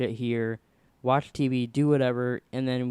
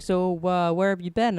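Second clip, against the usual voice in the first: higher-pitched imitation of a counselor; lightly voiced school counselor probing an absence; questioning counselor; 6 semitones higher, 4 dB louder than usual. Counselor questioning truant, retold.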